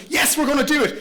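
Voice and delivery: loud, high burst of resolve; gung-ho frontal lobe. Acted. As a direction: cheer yourself on, all in. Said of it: "your frontal lobe says".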